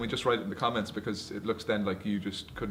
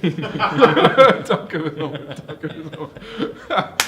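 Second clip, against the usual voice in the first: slow laughing plea; 7 dB louder, much slower than usual. Real, not acted. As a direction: drag it out laughing.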